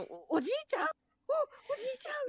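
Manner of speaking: mock panic, rising high; frantic cry to grandpa; panicked calling. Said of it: "みたいなことでしょ？".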